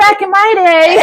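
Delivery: put-on high old-timer's grumble; hammed-up old codger reminiscing; grumpy old man. Co-host playing the old spirit guide, mock grumpy. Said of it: "that very grumpy old man".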